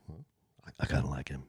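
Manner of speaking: breathy raspy whisper. Retold or imitated. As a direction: hush it down into a rasp. Husband still doing raspy voice.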